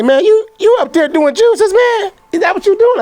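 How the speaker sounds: high, mock-incredulous friend voice; high teasing voice of a doubting friend. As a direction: play the buddy who can't believe it. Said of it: "They say".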